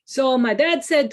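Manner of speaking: put-on light Puerto Rican accent for Juan. Speaker imitates Juan telling his story, in a light Puerto Rican accent. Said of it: "before I do this accent".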